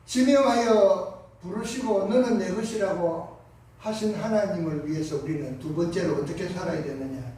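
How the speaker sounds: normal talking voice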